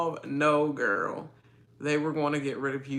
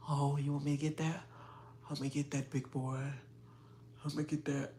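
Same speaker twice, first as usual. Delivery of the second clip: imitating his face; 11 dB quieter than usual. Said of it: "he can't even do this like I'm doing".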